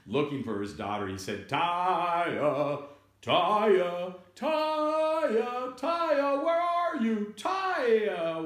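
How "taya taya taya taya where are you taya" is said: high, loud, sing-song call; father hollering the name, drawn out. Acted. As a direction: call across a store for a child. Father calling for lost daughter.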